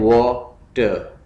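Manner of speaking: Water as वा, pause, टर; split into syllables. Shown as a demonstration. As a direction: break water into two separate beats. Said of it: "इसके syllables हैं".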